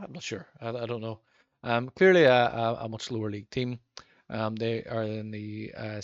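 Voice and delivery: ordinary talk, nothing marked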